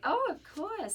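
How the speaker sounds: high, eager mom voice; imitating his mom. Son imitating his mom agreeing to a late call, high eager. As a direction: chirp it like a delighted mother. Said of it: "And she's like".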